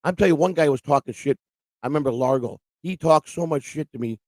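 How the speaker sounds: ordinary delivery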